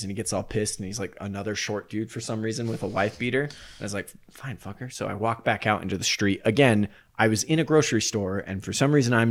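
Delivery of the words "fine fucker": very soft, imitated grumble; grudging retreat. Dreamer giving up on the shop guy, soft and grudging. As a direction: drop the volume right down, grudgingly.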